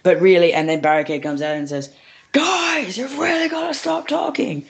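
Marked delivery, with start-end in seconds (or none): 2.3-4.6 high, slow policeman's voice scolding the pair; stern cop voice. Acted. Barricade the cop scolding chatty Autobots, high and slow. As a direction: play the cop telling them off, slowly and high.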